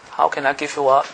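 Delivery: usual speaking voice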